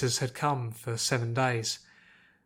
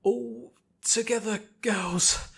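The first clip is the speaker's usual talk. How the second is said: high, slow rousing call; rally the team; rallying cry; 9 semitones higher, much slower than usual. Acted. Twilight rallying her friends, slow and rousing. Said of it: "Twilight rallied her friends".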